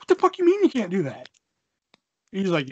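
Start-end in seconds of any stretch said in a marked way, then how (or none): 0.0-1.3 high, loud, indignant comeback; outraged. Retold or imitated. Teen challenging buddy's refusal on phone, outraged. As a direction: throw the words back in disbelief.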